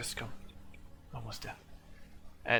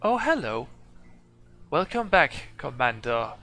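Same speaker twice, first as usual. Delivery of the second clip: robot medic; 2 semitones higher than usual. Acted. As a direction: greet the commander like a polite machine. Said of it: "just walks over and says".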